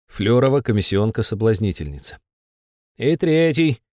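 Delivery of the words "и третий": high, anxious little demon; a cowed imp giving in; nervous demon voice. Acted. Besov voiced.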